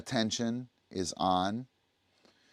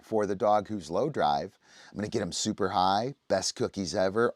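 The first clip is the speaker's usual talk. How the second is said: ordinary talk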